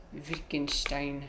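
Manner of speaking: "Wittgenstein" slow and soft in a German accent; German pronunciation. Shown as a demonstration. Player attempting the real German pronunciation, German accent. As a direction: sound out "Wittgenstein" slowly the German way. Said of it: "he's saying the real German pronunciation".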